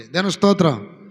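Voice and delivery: shouted praise to God; ringing Praise the Lord; loud praise. Acted. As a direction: call out praise to the church. Praise exclamation.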